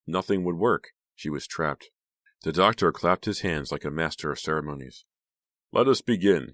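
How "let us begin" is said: raised, louder announcement; loud, lifted ceremonial call. A narrator's reading. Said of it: "he called".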